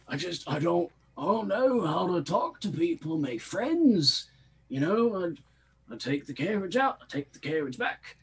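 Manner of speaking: high-pitched, put-on plaintive character voice; lonely Shlum, plaintive. Lonely driver confiding in Garlock, high-pitched lament. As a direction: pour out woes in a high, swooping voice.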